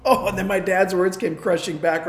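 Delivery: usual speaking voice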